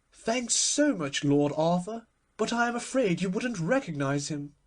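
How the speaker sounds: a hint of a chattering grande dame; light high-society hostess voice. A narrator's reading. Hostess Lady Windermere replies.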